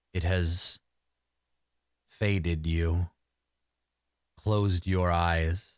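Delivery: low, slow and resonant forest spirit; deep resonant voice. Acted. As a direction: speak slow and low like an ancient deer spirit. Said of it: "he says in this, like, deep, like, resonant voice".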